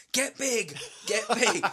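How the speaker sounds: high, loud shouted command; imitated TV shouting. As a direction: yell it like the sitcom character. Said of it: "when he's shouting".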